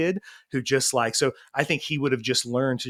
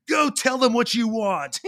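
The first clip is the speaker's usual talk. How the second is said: mock coach; 7 semitones higher than usual. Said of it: "coaches were like".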